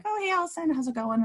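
high bright mock welcome; imitating sunny AA folks; cheery greeting. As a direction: chirp it like an eager greeter. AA members greeting her brightly.

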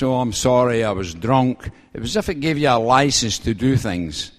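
ordinary talk

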